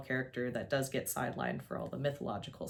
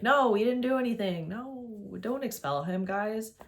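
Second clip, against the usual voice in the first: pleading friend; 6 semitones higher than usual. Retold or imitated. Grover pleading for Percy.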